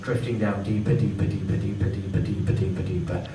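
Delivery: low, sinking hypnotist count; hypnotic deepening. Acted.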